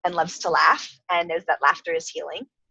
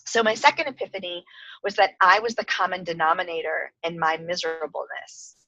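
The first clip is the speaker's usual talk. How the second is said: ordinary talk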